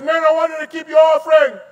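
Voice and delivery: high, loud and fast command; stern loud command. Acted. God telling the men to keep their offering, loud. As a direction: order them to keep it.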